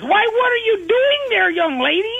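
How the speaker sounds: high, sweet cartoon granny voice; a high-pitched hammed-up country granny; old granny voice. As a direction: play the folksy grandma way up high. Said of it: "Granny Loomis comes in, and she says".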